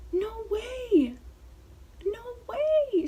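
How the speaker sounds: imitating very high, drawn-out amazement; shocked grandma. Grandma hearing the tattoo is real, shocked. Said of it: "she was just so shocked".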